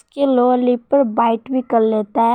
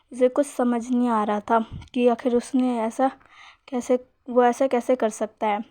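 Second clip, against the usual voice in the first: usual speaking voice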